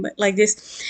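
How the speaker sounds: ordinary talk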